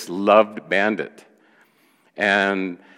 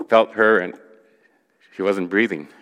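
ordinary talk

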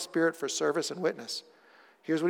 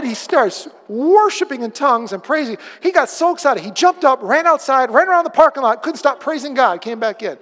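loud, high fervent preaching; preaching at full force; 9 semitones higher, 11 dB louder than usual. Acted. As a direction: let the story burst out with excitement.

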